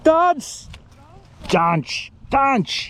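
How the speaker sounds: put-on high, punchy German-accented call; mock German command. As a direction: call it out like a German techno shout. Playful German techno dance command.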